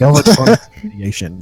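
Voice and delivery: Galvatronic forced out high, with scorn, stress on Galvatronic; reluctant mock disgust. Shown as a demonstration. Mocking the show's made-up word, said with scornful reluctance. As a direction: spit out the silly word Galvatronic like it tastes bad. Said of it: "I can't even bring myself to say it".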